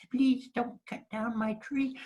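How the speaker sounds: tiny creature pleading with a woodcutter; little creature's plea. Acted. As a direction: beg for your tree in a tiny voice. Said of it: "a little creature down there going".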